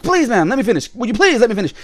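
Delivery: high-pitched pleading imitation; imitated exasperated plea. Imitates interrupted Canadian in film.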